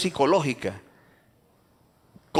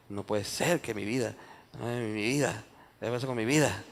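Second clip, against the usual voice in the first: soft, lowered self-pity; mock bitter lament; 3 semitones lower, 5 dB quieter than usual. Bitter person mourning lost years, acted lament. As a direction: dwell on the hurt, softly.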